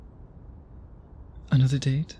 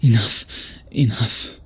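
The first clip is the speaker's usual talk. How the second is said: sharp, louder command; firm stop; 3 semitones lower, 4 dB louder than usual. Acted. Vampire sharply stopping himself from biting his lover. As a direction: cut it off as if forcing himself to stop.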